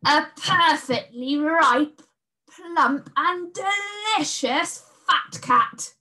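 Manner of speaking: high, slow, savouring each word; gloating beast. A narrator's reading. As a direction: lick your lips over the fat cat. Beast relishing the cat.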